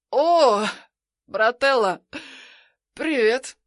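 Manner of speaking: tipsy hiccuping greeting; slurred drunk hello on the phone. Acted. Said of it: "Пьяно икаю в трубку".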